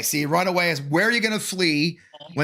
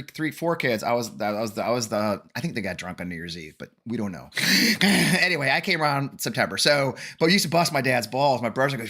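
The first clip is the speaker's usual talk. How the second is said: usual speaking voice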